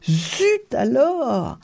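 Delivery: high, long-stretched exclamation; enthusiastic cry. A narrator's reading. Tourba feels a huge fish pull, crying out excitedly. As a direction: cry out slowly with unrepressed excitement. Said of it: "cria-t-il, incapable de réprimer son enthousiasme".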